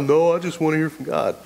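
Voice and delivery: mocking whine. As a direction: parody the lazy believer's sigh. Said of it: "Do you read the Bible?".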